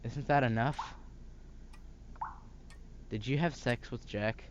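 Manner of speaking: normal talking voice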